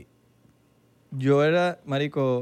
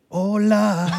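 a drawn-out sultry "Hola"; seductive hola; 6 semitones higher, 5 dB louder than usual. Shown as a demonstration. Pretending to text a crush, drawn-out sultry hola. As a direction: purr "Hola" like a flirty text. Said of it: "Lo que me salga".

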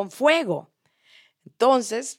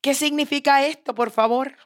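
higher-pitched daughter pleading for an explanation; lightly amused, pitched-up appeal; 7 semitones higher, 3 dB louder than usual. Acted. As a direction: question dad in a raised, playful voice. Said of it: "se ríe y le dice".

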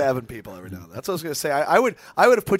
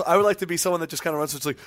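usual speaking voice

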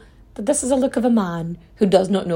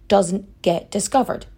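normal talking voice

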